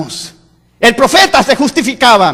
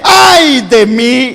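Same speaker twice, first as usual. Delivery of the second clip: anguished sigh; much slower than usual. Acted. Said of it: "y dice".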